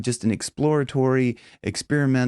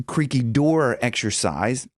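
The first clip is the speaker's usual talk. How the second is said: ordinary talk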